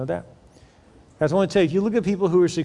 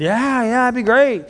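lightly acted keen yes, pitched up; eager reply; 6 semitones higher, 3 dB louder than usual. Pastor voicing anyone asked about goals, mildly eager. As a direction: play an eager person agreeing. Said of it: "You're like".

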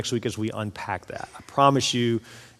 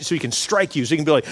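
ordinary delivery

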